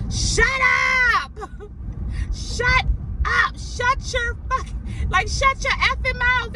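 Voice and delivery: high, shrill-pitched imitated yell; mock screaming caller. Caller snaps at the agent in a high mock yell. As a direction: scream it like he lost it. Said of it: "everything just flipped".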